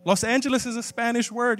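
ordinary talk

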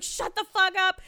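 high, exasperated mock yell; frantic, hammed-up shush; retold snapping back.

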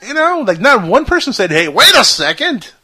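high, loud disbelief; incredulous. Real, not acted. Host amazed nobody objected to her book, incredulous. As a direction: protest it, voice shooting up. Mock outrage at no hate mail.

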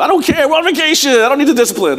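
high-pitched mock permissive dad; carefree indulgence. Dad giving in to kids on vacation, carefree.

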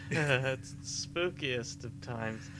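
slow eerie drawl; spooky slow. Pretend. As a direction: stretch it out to sound creepy.

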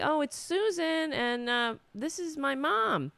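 high, singsong polite introduction; friend introducing mother. Retold or imitated. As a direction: imitate a friend calling back to a neighbor. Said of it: "And she's like".

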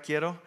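Quiero said the Spanish way, kee-EH-ro; Spanish pronunciation. Shown as a demonstration. Preacher comparing the Greek root to Spanish Quiero. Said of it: "it kinda sounds like Spanish".

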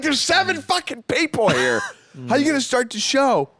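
retold outburst, pitch way up; incredulous protest. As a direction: protest in exasperated high voice. Retelling his protest to club staff.

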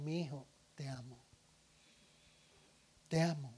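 soft, low, tender words to a son; tender parent. Acted. Parent assuring his son of love, tender and soft. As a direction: hush it lovingly like a parent.

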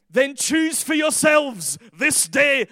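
loud, drawn-out Scottish proclamation; Scottish accent. Real, not acted. Preacher declaiming Joshua's challenge to the crowd, accidentally Scottish. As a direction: slip into a booming, slow Scottish accent. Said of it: "I don't know why I went Scottish".

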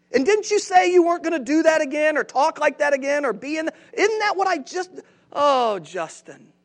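mock weary God sighing over Justin; a fed-up parent repeating himself; exasperated God. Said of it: "He's thinking to Himself".

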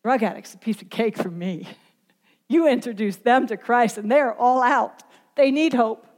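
usual speaking voice

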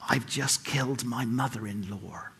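low, soft mock whisper; under his breath. President to guests shaking his hand, under his breath. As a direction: confide it very quietly under the breath. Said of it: "whispered under his breath".

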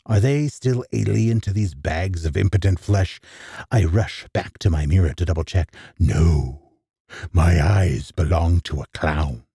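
plain reading voice

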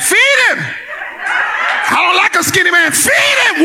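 mock shout. Preacher voicing the church teasing him, in a high mock shout. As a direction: belt it out like a teasing church mother.

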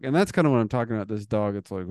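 usual speaking voice

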